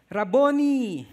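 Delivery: joyful recognition. Acted.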